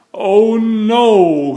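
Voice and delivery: high, loud dismayed groan. Acted. Mister Fussy hearing a crash outside, groaning in dread. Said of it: "he groaned".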